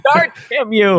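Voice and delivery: high, loud cry of mock frustration; exasperated mock shout. Host cursing at Dart in a bit, exasperated shout. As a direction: holler it as if scolding.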